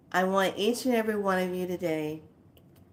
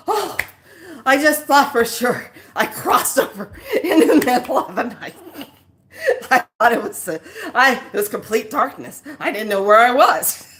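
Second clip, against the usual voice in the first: high, louder, laughing through the words; laughing mom; 6 semitones higher, 9 dB louder than usual. Retold or imitated. Mom explaining she thought she'd died, laughing. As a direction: giggle out the explanation.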